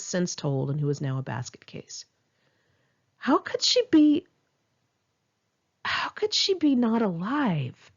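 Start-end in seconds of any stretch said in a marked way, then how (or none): 3.2-4.3 high, pained cry of disbelief; anguished disbelief. Acted. Grieving writer asking herself about her friend, anguished and high. 5.8-7.9 slow, drawn-out stunned question; slow disbelief. Acted. Grieving writer asking herself again, slow and stunned.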